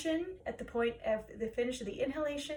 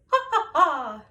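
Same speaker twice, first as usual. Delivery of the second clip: three punchy loud ha's; loud ha pulses; 2 semitones higher, 11 dB louder than usual. Shown as a demonstration. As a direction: bark each ha from the belly. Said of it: "repeat after me".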